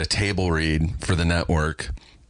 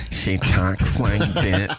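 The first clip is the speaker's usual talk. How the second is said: slow, drawn-out imitation of her speech; annoying put-on voice; much slower than usual. Describing the actress's character, in her annoying voice. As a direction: drag it out in her irritating manner. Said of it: "Is that a real voice like that?".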